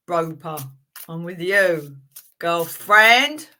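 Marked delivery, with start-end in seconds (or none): none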